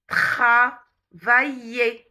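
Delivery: drawn-out syllables with R from the throat; slow, guttural R. Shown as a demonstration. Teacher models the French R for repetition, very slowly. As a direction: split each word into very slow syllables. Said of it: "On va répéter lentement".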